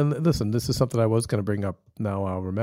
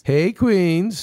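excited greeting; 8 semitones higher, 5 dB louder than usual. Acted. Echoed show greeting, louder.

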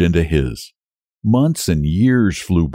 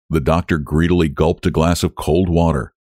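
ordinary delivery

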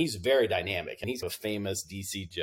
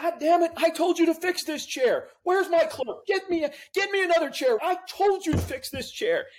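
very high, loud, strained mock yell of a furious judge; 4 dB louder than usual. Judge who fell from his chair yells at the clerk, furious.

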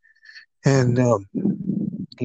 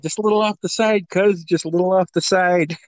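higher voice, lightly imitating a friend's plea; retold haircut plea; 5 semitones higher than usual. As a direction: plead lightly with the barber, pitched up. Same retold plea to barber.

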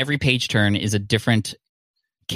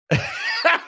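a sudden high laugh of delighted surprise; real burst of laughter. Real, not acted. Opening the gift box and finding a rock, bursting into high laughter.

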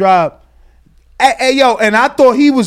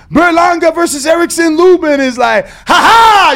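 mocking shout; 8 semitones higher, 5 dB louder than usual. Real, not acted. Mocking the fight matchup.

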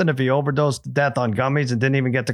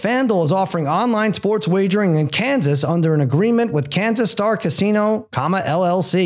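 fast legal disclaimer; 3 semitones higher than usual. Acted. Sponsor legal disclaimer read.

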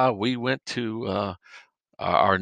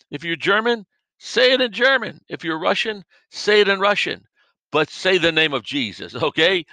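high, loud preaching voice; fiery preaching; 4 semitones higher, 3 dB louder than usual. Real, not acted. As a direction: drive the point home from the pulpit. Preaching at full force.

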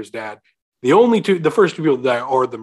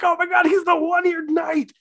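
high-pitched excited shout; awestruck kid. Retold or imitated. Host as his eleven-year-old self at the reveal, awestruck shout. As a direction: shriek it like a child stunned by a twist.

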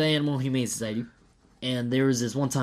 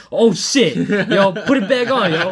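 louder, higher panicked urging; alarmed dad, louder and higher; 7 semitones higher, 9 dB louder than usual. Retold or imitated. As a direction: retell the panic, pressing him to hold it on. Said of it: "I was like".

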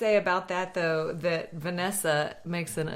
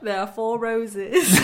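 put-on slow, overdone Canadian accent; hammed-up mock Canadian accent; attempted Canadian accent; 3 semitones higher, 4 dB louder, slower than usual. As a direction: drag it out in a bad Canadian accent. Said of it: "very difficult to do a Canadian accent".

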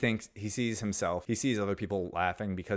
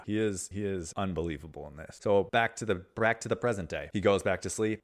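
ordinary talk, nothing marked

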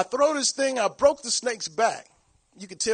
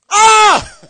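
piercing high scream of fright; retold scream; 12 semitones higher, 18 dB louder than usual. Man screaming as a snake appears in the kitchen. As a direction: shriek at the sight of the snake. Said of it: "and I screamed".

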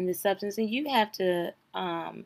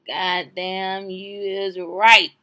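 usual speaking voice

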